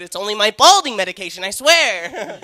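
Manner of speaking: squeaky pleading excuse, hammed up; mocking the friend's voice. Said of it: "That's how my friend talks".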